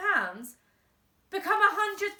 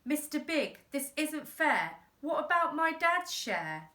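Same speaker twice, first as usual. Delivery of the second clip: put-on boy protesting; 6 semitones higher, 3 dB louder than usual.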